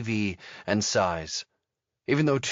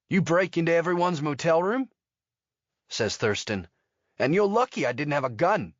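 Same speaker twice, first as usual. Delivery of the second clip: 1 indignant retort; 7 semitones higher than usual. A narrator's reading. Thurston retorts at Terry.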